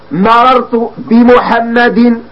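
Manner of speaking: مررت بمحمد said very slowly, the final kasra on بمحمد sounded out; slow textbook demo of مررت بمحمد with audible kasra; clearly voiced ending. Shown as a demonstration. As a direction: stretch مررت بمحمد and let the i-ending on بمحمد ring. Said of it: "تلفظنا بالعلامة أم لا؟".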